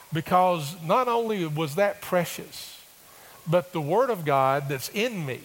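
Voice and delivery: ordinary talk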